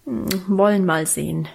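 ordinary delivery, nothing marked

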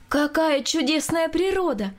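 high, drawn-out admiring exclamation; exclaiming. A narrator's reading. Wife admires the painted landscape, exclaiming. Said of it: "воскликнула жена Николая Николаевича".

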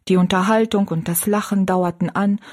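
ordinary delivery

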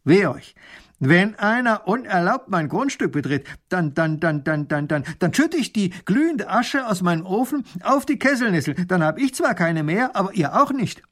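put-on high, spluttering old man's threat; really angry. A narrator's reading. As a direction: threaten the intruders, stammering with anger. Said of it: "Da wird der Alte richtig böse".

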